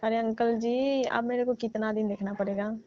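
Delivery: ordinary talk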